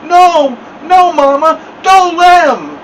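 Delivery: high, loud muffled protest; frantic pinched pleading; mumbling, mouth stuck. A narrator's reading. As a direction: panic through stuck lips. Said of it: "and mumbling".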